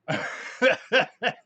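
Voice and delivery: loud, high burst of laughter; high cackling laugh; hearty laugh. Real, not acted. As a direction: crack up loudly. Laughs at own remark.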